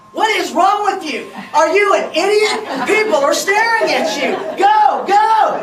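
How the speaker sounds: loud, high, frantic telling-off; exasperated scolding. Retold or imitated. Wife scolding her husband in the truck, loud and exasperated. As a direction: ironic soothing tone that is anything but. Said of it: "with her soothing and empathetic tone".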